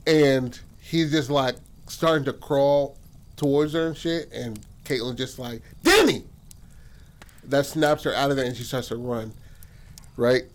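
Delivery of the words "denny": high, loud imitated call; urgent cry. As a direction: yell the name in sudden alarm. Caitlyn cries out Denny's name.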